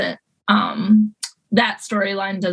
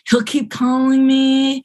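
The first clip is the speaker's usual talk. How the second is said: slow, slightly louder reluctant voice; voicing Cameron; 4 semitones higher, 3 dB louder, slower than usual. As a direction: drag it out like a reluctant, guilty friend. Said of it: "Cameron thinking about whether".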